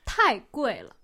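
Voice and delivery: 太 and 贵 each loud and falling steeply; fourth-tone demo. Shown as a demonstration. Teacher modelling a haggling phrase, 太贵了 in emphatic falling tones. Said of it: "let's move on to this".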